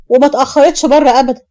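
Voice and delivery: ordinary delivery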